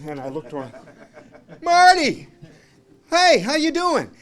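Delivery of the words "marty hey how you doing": high, loud cheerful hail; loud greeting. Retold or imitated. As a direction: call out a happy hello across the sidewalk. Greeting Marty loudly on street.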